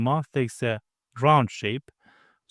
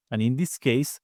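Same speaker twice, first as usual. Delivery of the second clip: ordinary talk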